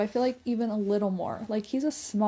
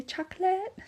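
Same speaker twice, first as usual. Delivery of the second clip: put-on high little-kid voice; toddler word; 9 semitones higher than usual. Mom imitating how her toddler says chocolate, high. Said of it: "one of the words he can say".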